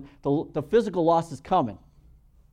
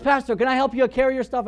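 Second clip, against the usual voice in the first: put-on bright, eager kid offering help, pitched higher; 8 semitones higher, 6 dB louder than usual. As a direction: play the keen young guy offering to carry the bag. Kid offers to carry briefcase.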